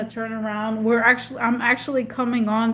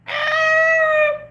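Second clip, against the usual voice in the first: high joyful shriek; 4 dB louder than usual. Real, not acted. Streamer squealing at a returning friend. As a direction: squeal of delight. Overjoyed reunion exclamation.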